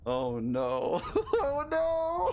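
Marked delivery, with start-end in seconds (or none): 1.1-2.3 laughing in disbelief. Real, not acted. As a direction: giggle through oh no. Laughing at disaster.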